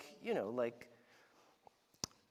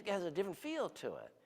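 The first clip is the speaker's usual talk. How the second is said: ordinary talk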